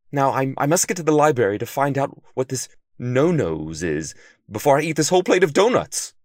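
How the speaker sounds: flustered panic. A narrator's reading. Detective who ate a whole donut, flustered panic. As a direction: stammer in guilty alarm. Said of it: "Sylvester said".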